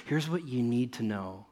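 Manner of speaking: usual speaking voice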